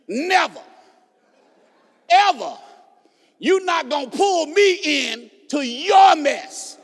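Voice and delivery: loud, drawn-out emphatic refusal; preacher's emphatic, defiant cry. Acted. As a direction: punch each word, with pauses between. Acted defiant refusal to brother.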